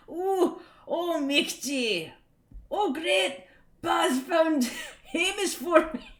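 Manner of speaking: put-on high, excited Scots accent. Comic boy delighted his hedgehog is found, Scots accent. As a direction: exclaim it in a hammed-up Scots voice, breaking into laughter. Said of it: "You have to do the accent".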